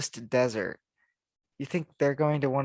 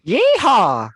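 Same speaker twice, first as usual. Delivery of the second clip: high, full-volume yeehaw; loud cowboy whoop; 11 semitones higher, 14 dB louder than usual. Pretend. Cheering the move to Texas, a loud high whoop. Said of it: "Sorry if that was loud".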